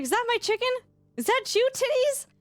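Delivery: high-pitched, questioning call; imitated chicken-chasing call. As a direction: mimic someone calling out high for a lost pet chicken. Imitates streamer calling her chicken.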